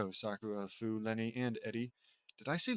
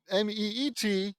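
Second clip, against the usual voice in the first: usual speaking voice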